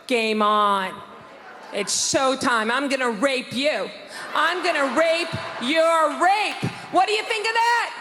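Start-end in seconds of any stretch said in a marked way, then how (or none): 4.3-6.6 slow, drawn-out, shouted threat; slow menacing threat. Acted. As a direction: spell it out slowly and menacingly.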